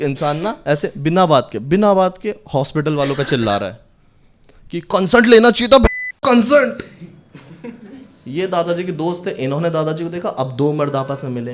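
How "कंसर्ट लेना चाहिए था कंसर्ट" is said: loud, high complaining shout; angry patient yelling at hospital. Retold or imitated. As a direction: shout it at the staff. Man yelling at hospital staff.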